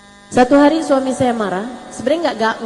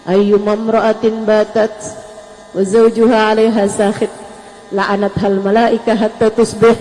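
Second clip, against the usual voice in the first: hadith recitation; 3 semitones lower, 4 dB louder than usual. Acted. Quotes the hadith in Arabic.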